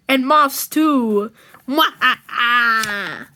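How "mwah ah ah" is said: slow, drawn-out villain cackle; evil laugh. Acted. Villain sending his pet off, slow evil cackle. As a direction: gloat with a slow mwah-ah-ah.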